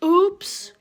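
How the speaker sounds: high, loud startled oops; cried oops. Acted. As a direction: yelp it out. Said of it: "cried Froggy".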